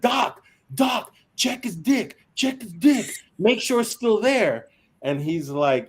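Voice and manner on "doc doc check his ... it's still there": high-pitched urgent calling out to the medic; retold frantic yell. Squad members calling to the medic, urgent high mock shout. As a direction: pitch it way up like panicked soldiers shouting over a wounded man.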